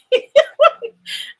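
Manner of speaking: high, loud laugh at his own mistake; burst of laughter. Real, not acted. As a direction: crack up at the slip.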